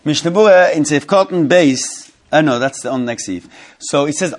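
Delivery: ordinary talk, nothing marked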